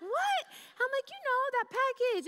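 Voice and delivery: very high startled What then explaining; baffled shriek. Retold or imitated. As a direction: squeal in confusion. Her baffled reply retold.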